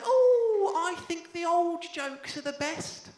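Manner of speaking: put-on high, slow old granny voice; old nana. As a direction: play his sweet old nana. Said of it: "she said".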